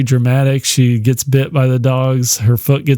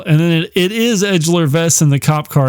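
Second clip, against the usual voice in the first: ordinary talk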